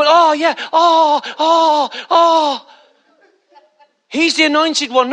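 mock awed crowd.

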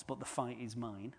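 ordinary delivery

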